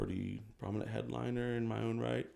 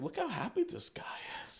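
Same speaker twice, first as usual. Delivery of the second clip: whisper; 5 dB quieter than usual. Retold or imitated. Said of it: "They're just like".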